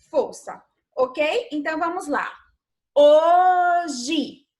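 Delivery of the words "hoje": "Hoje" drawn out loud, leaning on HO; teacher sounding out HO-je; stressed syllable demo. Shown as a demonstration. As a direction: say "Hoje" slowly and loudly, stretching the first syllable. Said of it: "em voz alta".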